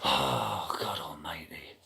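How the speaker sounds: weary breath of a whisper; exasperated whisper. Real, not acted. Customer worn out by the phone saga, exasperated whisper. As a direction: sigh it out with no voice, fed up.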